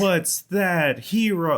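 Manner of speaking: very slow, high quiz announcer; Pokemon narrator voice. Pretend. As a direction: stretch it like a cartoon quiz. Joins the Pokemon quiz joke.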